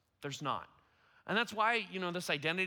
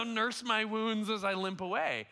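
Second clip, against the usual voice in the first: normal talking voice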